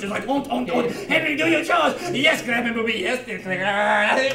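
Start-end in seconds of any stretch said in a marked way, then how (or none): 0.3-2.0 higher, louder hammed-up German parent; mock German accent. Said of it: "acting all German". 2.1-4.2 lightly hammed German kid answering; a hint of a dutiful German boy, slowing at the end; boy playing along in fake German; mock German accent. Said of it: "acting all German".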